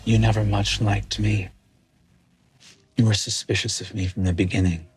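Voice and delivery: low, cold villain voice gloating over the hero; a villain coolly promising to send her home; menacing villain. Acted. Villain menaces the hero, low and cold.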